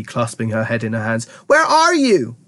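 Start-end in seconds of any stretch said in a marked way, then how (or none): 1.5-2.4 high, frantic call; screamed. A narrator's reading. Mother screaming for her vanished son. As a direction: cry out searching for your child. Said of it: "screamed Missus T V".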